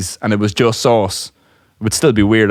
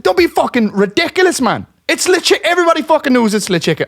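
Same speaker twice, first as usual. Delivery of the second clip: high-pitched, exasperated certainty; mock-sincere insistence. Teller scolding doubting coworker over French, mock-exasperated. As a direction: insist on the nonsense as if deadly sincere. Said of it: "I was just being, like, really sincere".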